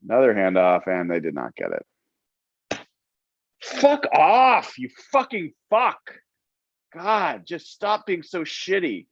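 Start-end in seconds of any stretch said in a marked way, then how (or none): 3.6-9.1 furious high-pitched outburst at the TV; swearing tirade. Real, not acted. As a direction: yell curses in frustration. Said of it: "[name] watching a Seahawks game experience".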